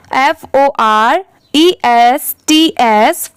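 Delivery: ordinary talk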